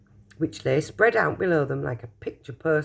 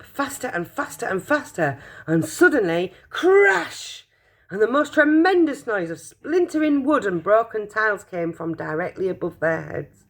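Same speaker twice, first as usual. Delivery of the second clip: ordinary delivery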